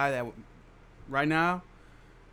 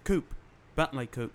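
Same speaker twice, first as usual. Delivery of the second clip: lightly attempted Australian accent; Australian-sounding accent. Shown as a demonstration. Man redoing his nickname for friends, shaky Australian accent. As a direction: say it with a botched Australian twang. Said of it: "You sound Australia".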